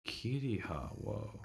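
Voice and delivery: ordinary talk, nothing marked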